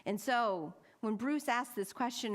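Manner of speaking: ordinary talk, nothing marked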